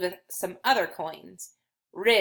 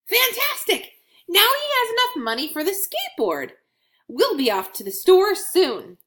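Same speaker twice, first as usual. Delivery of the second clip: higher, overjoyed little coin; thrilled coin Scratch; 11 semitones higher, 4 dB louder than usual. Acted. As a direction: celebrate reaching the goal. Coin voiced in children's story.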